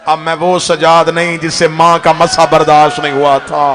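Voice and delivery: starts low then swells into a loud wailing cry; grief building to a cry. Acted. Sajjad tells his uncle of Sham, building anguished cry. Grieving recitation rising to cry.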